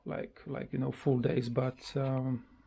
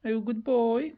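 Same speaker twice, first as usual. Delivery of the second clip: high, sing-song voice for the bird; cooing pet talk; 7 semitones higher than usual. Real, not acted. Owner coos at her bird, high and sing-song.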